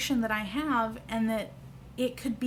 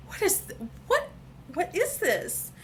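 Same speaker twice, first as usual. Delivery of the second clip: stunned disbelief; 8 semitones higher than usual. Retold or imitated. Stunned by new cheekbones.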